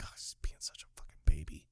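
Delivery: quick, hushed whisper; imitated silent mouthing, all breath; mouthed aside. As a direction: whisper it as a secret jibe. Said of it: "he mouths to Donnie".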